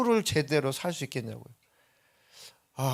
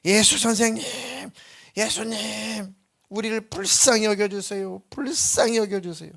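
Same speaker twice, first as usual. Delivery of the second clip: crying out to Jesus; 7 semitones higher than usual. Retold or imitated. Lepers calling to Jesus from afar, high pleading cry. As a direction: call out desperately from a distance. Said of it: "이렇게 소리를 질렀단 말이죠".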